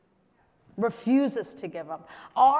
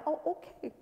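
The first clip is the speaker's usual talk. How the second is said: small, high, softer acceptance; meek giving up; 4 semitones higher, 8 dB quieter than usual. Acted. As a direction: back down at once, mock meek. Imagined meek acceptance of rejection.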